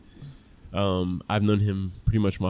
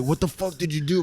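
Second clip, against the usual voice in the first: higher-pitched retold outburst from an angry father; mock-angry dad, pitched up; imitating angry dad; 6 semitones higher than usual. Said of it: "super angry".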